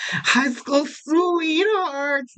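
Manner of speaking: high, very slow dreamy gush; mock swooning. Teasing viewers about the ringside romance, mock swoon high and slow. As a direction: swoon over it like a lovestruck fan, then drop it. No, I'm just kidding.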